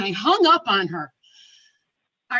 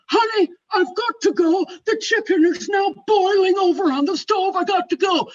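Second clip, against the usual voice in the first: high, loud urgent pleading; urgent, harried woman; 9 semitones higher, 7 dB louder than usual. Retold or imitated. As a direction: insist loudly that you must hang up. Harried woman ending a sales call.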